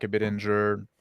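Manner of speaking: normal talking voice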